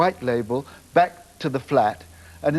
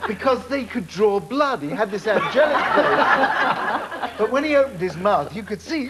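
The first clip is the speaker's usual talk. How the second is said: laughing; 6 semitones higher than usual. Real, not acted. Laughing while recalling teeth.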